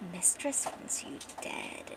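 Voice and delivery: slow, sinister whisper; menacing whisper. Acted. Assassin over his sleeping victim, menacing whisper. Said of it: "this guy whispers".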